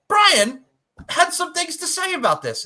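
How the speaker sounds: high, loud hyped lead-in; hyped announcer intro. Real, not acted. Introducing the clip to listeners, a high hyped announcer lead-in.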